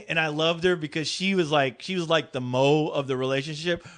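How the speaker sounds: ordinary talk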